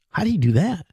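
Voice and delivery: ordinary talk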